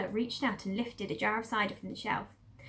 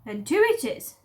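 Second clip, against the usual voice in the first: louder servant voice; Mabel's voice; 3 semitones higher, 8 dB louder than usual. Acted. Farm woman settles on two jars. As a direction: settle it briskly.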